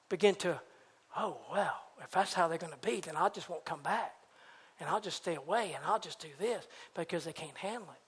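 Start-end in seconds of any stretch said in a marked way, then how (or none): 1.2-6.6 low, soft resigned murmur; quiet withdrawal. Acted. Hurt churchgoer decides to stay away, low quiet resignation. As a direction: shrink back and give up softly.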